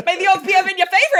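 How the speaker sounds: high, fast, laughing bad British accent. Shown as a demonstration. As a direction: ham up a British accent through giggles. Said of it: "I can't do a British accent".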